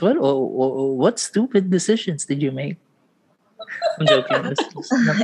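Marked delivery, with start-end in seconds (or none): 4.0-4.4 laughing I'm joking. Real, not acted. Backs off teasing.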